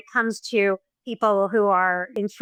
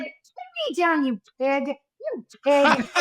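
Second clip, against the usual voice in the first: imitating an indignant mother, higher-pitched; mock indignation; 4 semitones higher, slower than usual. Mother telling off the film star who picked her up, mock indignant. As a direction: playfully act out an offended scolding.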